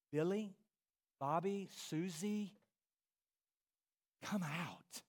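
soft call by name, falling away; hushed, tender summons; gentle calling. Acted. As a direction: call each one softly, with pauses. Preacher voices Jesus calling softly.

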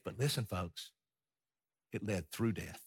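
low, soft point-making; hushed emphasis. Real, not acted. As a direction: lean in and drop the voice. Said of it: "preacher lowers for emphasis".

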